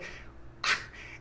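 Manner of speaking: long, soft exhaled sigh; exasperated sigh. Real, not acted. Vlogger giving up mid-explanation, exasperated sigh. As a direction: breathe out in wordless frustration. Exasperated over booster rules.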